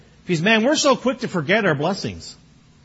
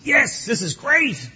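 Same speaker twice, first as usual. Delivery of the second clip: excited; 5 semitones higher, much slower than usual. Retold or imitated. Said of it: "I'm all excited".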